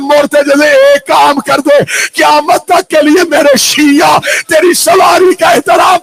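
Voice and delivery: high, loud crying words; weeping command. Acted. Husain weeping as he commands his horse. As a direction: cry it out. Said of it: "Hussain रो के कहते".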